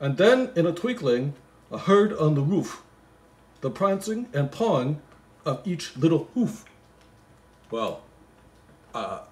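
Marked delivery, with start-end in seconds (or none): 0.1-6.7 poem recital. Acted. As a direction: read it like a bedtime Christmas poem. Reading the poem aloud.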